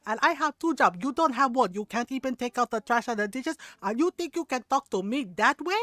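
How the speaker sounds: imitated scolding rant in a Filipino accent; yelling Filipino mom. Tiny mom scolding son who won't do dishes, angry imitation. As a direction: hammer out mom's angry tirade, mock-furious. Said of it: "and she's yelling".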